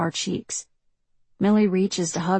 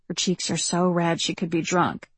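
plain reading voice

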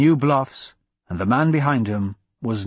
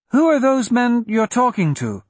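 put-on high, questioning child's voice; young girl; 10 semitones higher, 3 dB louder than usual. A narrator's reading. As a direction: pitch it up like a little girl.

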